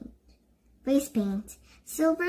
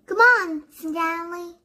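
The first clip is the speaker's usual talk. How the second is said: loud, high call across the stage; sharp summons to her friend; bossy call; 7 semitones higher, 5 dB louder than usual. A narrator's reading. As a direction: call him over impatiently. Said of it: "says Milani".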